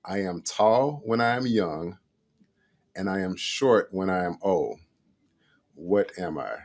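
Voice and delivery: slow, deliberate riddle reading with long pauses. Shown as a demonstration. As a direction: read each phrase slowly so it lands. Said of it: "I'm gonna read it very, very slow".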